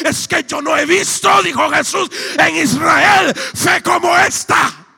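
high, shouted testimony; full-force preaching. Acted. Preacher retelling his challenge to the crawling man, full-force shouted preaching.

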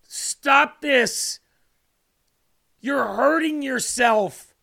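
loud, high, drawn-out scolding; exasperated plea. Acted. Preacher voicing Jesus to a suffering believer, exasperated. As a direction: cry out to stop them hurting themselves. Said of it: "He's probably going".

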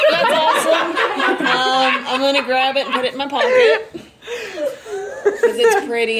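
giggly and loud; laughing delight. Real, not acted. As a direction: laugh through the words. Delighted laughing over rock.

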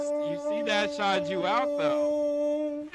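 hammed-up call-out. Hammed-up calling out drunk text.

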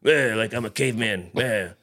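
mock deep-voiced caveman thug; Aiden. [name] mimics younger man's deep caveman voice, mocking impression. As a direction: imitate a grunting nineties rapper caveman. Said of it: "He's got this deep voice".

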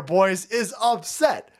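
ordinary delivery

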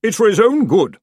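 high, loud protest; insistent aunt. A narrator's reading. Aunt arguing through the door, loudly insistent. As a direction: insist with pitch and volume right up. Peevish aunt argues back.

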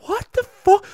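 high, loud What the fuck?; mock rage at the advice; exasperated yell; angry outburst. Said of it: "I got so irrationally angry".

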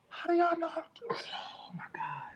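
quick whispered aside. Real, not acted. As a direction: whisper it under your breath.

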